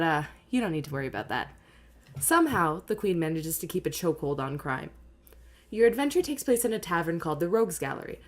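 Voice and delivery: lightly voiced scene setting; game master narration. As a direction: read it like a dungeon master.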